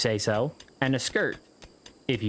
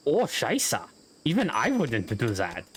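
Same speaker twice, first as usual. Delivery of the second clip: put-on Hitler, German accent. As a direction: do a rough comic German accent. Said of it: "that was my best German accent".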